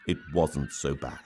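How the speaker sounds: ordinary delivery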